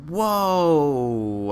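loud rising whoa of surprise; surprised whoa. Real, not acted. Gamer startled by a skipped shot, loud whoa. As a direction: react to the sudden jump, startled.

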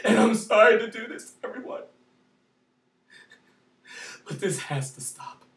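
quiet pleading aside; hushed. Real, not acted. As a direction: drop to a hush after the rage. Sudden hush after screaming.

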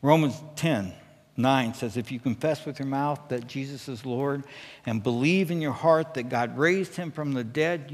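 usual speaking voice

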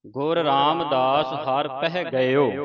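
slow, measured verse recitation; slow devotional reading; scripture recitation. Shown as a demonstration. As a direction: recite with long drawn-out vowels.

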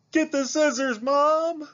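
high, loud frantic yell; girl yelling at her mother for scissors. Acted. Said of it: "Mallory yelled".

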